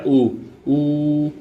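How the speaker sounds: ऊ held long, made with the lips; lip-formed vowel. Shown as a demonstration. Teacher showing a lip sound to students, drawn-out ऊ. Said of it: "जो ओष्ठ touch करें".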